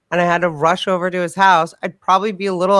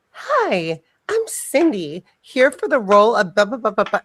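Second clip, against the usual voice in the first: sweet auditioner; 3 semitones higher than usual. Retold or imitated. Driver walking into an audition, mock sweetness. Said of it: "They'll be like that in a car, and then they'll walk into an audition like".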